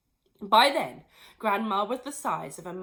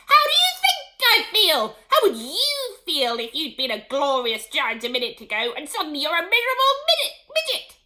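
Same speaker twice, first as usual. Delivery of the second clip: furious yelling; 9 semitones higher, 12 dB louder than usual. Acted. Grandma rages on.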